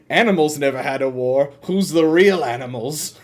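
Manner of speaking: a hint of an old cartoon professor; Professor Farnsworth. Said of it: "a quote from Professor Farnsworth from Futurama".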